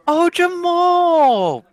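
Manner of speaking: high, slow delighted cry; delighted greeting. Acted. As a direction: sing out his name with joy. NPC greets excitedly.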